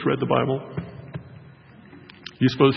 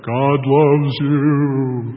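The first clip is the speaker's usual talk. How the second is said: very slow, louder booming echo; hollow, drawn-out echo in a mailbox; echoey mailbox voice; 3 semitones higher, 8 dB louder, much slower than usual. Retold or imitated. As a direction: boom it out like inside a metal box. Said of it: "it's more like".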